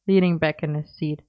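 usual speaking voice

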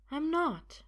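high-pitched woman's denial; high, assured contradiction; insistent woman. Acted. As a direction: deny it simply, pitched high. Sophia insists.

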